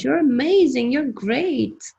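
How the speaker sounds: a silly cheering voice for fun; funny voice. Shown as a demonstration. Chorus cheering your name in a funny voice. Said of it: "say it in a funny voice".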